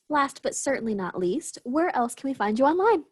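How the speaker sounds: ordinary delivery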